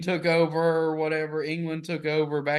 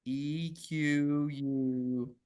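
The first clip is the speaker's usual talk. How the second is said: slow, softer letters one by one; spelling it out; 2 semitones lower, 5 dB quieter, much slower than usual. Real, not acted. Friend typing a search, slowly spelling the letters. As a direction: sound out each letter deliberately.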